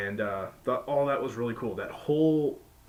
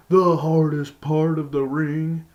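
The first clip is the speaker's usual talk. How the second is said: slow, much louder, every word leaned on; the hardest part of the ring hammered out slowly and loud; heavy emphasis; 5 semitones higher, 10 dB louder, slower than usual. Real, not acted. Reviewer stressing the brutal move on the apron, slow loud emphasis.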